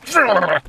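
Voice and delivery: quick amused laugh; short laugh. Real, not acted. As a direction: laugh off the dumb question. Laughs at the mocked question.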